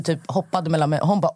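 ordinary delivery

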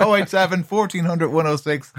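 talking through a mouthful of biscuit; mouth full. Real, not acted. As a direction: mouth full of fig rolls. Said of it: "my mouth is full of fig rolls".

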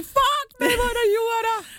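high mock-horrified cry from the friends; friends' dismay. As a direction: squeal it like a party friend hit by bad news. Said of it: "Sun kavereiden ainoa ajatus oli". (korkea, leikillisen kauhistunut huudahdus)

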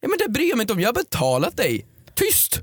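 high-pitched, exasperated outburst; protest, pitch leaping up on the final command; indignant demand. Acted. Paying customer overruling chatty hairdressers, indignant.

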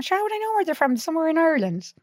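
high-pitched mock child's retort; child's protest. Child answering mother's questions about accents, high-pitched protest. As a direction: pitch it right up like a kid who couldn't know.

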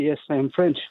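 usual speaking voice

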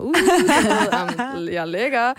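drawn-out preening "uh", high and loud, then smug; playfully feeling oneself; mock self-satisfied. TikToker strutting after being recognized, mock self-satisfied. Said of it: "jeg går rigtig der og føler mig".

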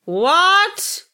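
high loud shocked what. Real, not acted. Co-host hearing of his many children, shocked outburst. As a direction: blurt out in disbelief.

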